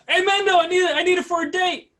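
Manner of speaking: high, loud whiny begging voice; pleading friend. Retold or imitated. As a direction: beg Mando like a desperate buddy. Friend begging Mando, acted quote.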